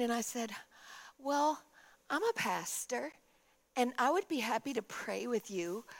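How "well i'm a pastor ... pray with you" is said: soft, hesitant, caring pastor; gentle offer. Retold or imitated. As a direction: offer it softly, with pauses. Pastor gently offers prayer to stranger.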